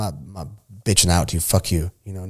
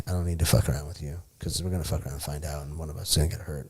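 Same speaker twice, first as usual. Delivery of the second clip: whispered, under the breath; hushed; 5 semitones lower, 9 dB quieter than usual. Real, not acted. Telling friends how the fight was avoided, whispered. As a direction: let it drop to a whisper, serious. Quiet warning after the near fight.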